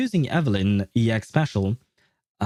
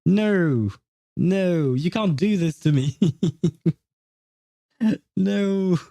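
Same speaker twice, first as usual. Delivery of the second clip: high, protesting no through amusement; playful dismay, pitched up; mock-distressed refusal; 6 semitones higher, slower than usual. Real, not acted. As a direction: refuse to accept it, half laughing. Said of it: "no, I refuse".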